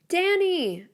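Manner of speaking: high calling cry of a name. Acted. Girl falling in the forest calls to her friend, a high cry. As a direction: call his name as she falls. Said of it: "I cry out".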